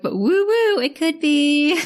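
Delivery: mock the scientist as dreamy and vague; mock mystical scientist. Mocking Dr O'Keefe's vague claim.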